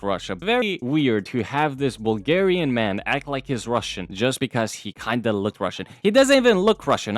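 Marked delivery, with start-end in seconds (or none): 6.0-7.2 higher and louder, exasperated disbelief; incredulous outburst. Real, not acted. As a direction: blurt it out, can't believe it. Incredulous rant about Rusev's nationality.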